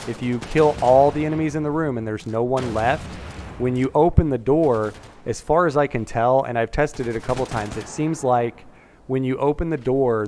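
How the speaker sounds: ordinary delivery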